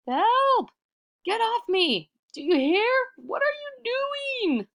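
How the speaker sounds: high, loud panicked yelling; trapped cry for help. Acted. Iktomi pinned under the rock, yelling at it in panic. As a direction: yell up at the boulder, pinned and desperate.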